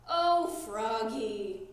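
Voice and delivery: slow, drawn-out weary groan. A narrator's reading. Dad groaning at forgetful Froggy. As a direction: let it sag out of you. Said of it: "groaned his dad".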